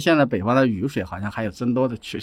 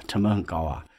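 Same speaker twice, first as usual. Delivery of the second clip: usual speaking voice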